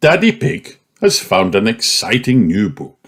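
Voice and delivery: ordinary delivery, nothing marked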